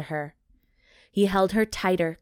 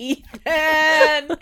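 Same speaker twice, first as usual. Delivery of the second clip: mock call; 9 semitones higher, 8 dB louder than usual. Calling out the name.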